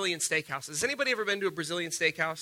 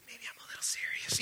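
sheepish whispered aside; whispered confession; 11 dB quieter than usual. Pretend. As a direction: admit it under your breath, half joking. Confessing aside about hated dog.

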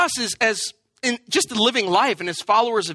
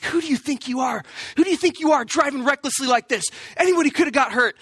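angry driver; 3 semitones higher than usual. Retold or imitated. Angry driver confronting him.

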